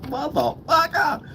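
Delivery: high-pitched, slow swear with a laugh; laughing, squeaky-high drawn-out exclamation; high drawn-out curse. Real, not acted. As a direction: stretch the curse out high, syllable by syllable.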